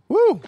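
high whooping woo; woo from the heat. Real, not acted. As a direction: let out a loud hot-pepper woo. Reacting to hot sauce heat.

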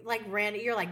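ordinary delivery

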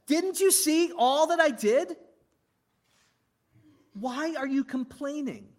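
high-pitched, indignant questioning; loud and high, easing slightly; exasperated God. Acted. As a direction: demand an answer as an exasperated God. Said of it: "That's what God says".

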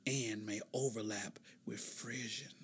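whisper. Pretend. Whispers the ASMR definition.

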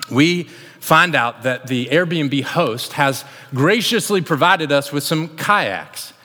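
ordinary delivery

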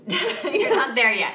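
high, loud, slowed by laughter; laughing correction. Real, not acted. Host teasing co-host for jumping in, laughing. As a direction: break up laughing as you correct them.

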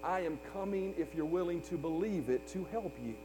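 God gently promising to come; tender voice of God. Acted. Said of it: "looks at us steadfastly and says".

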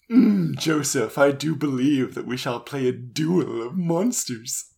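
theatrical posh villain, drawn-out opening; mock-grand villain; Maximilian Pegasus. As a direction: savour each word like a cartoon schemer. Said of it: "Maximilian Pegasus".

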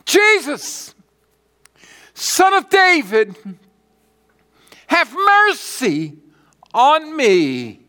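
high, loud cry for mercy. Acted. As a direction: cry out like a blind beggar desperate to be heard. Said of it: "And he cried out".

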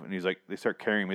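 ordinary talk

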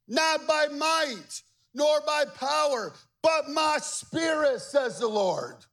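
proclaimed scripture. Acted. Quoting scripture at full force.

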